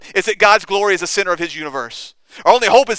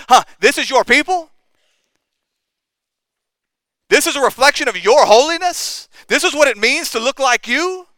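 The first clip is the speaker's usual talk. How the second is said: higher, mocking jeer at God; scoffing; 8 semitones higher, 3 dB louder than usual. Nations mocking God over his unholy people, scornful. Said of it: "the nation scoffed at God".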